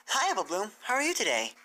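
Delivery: put-on a cheerful young mare saying hello; Twilight's voice. Twilight greets Apple Bloom.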